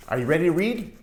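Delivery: ordinary delivery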